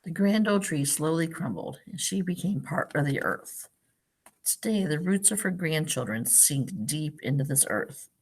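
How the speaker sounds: gentle storybook read-aloud; picture-book narration for a class; reading to children. Acted. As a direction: read it like story time for little kids.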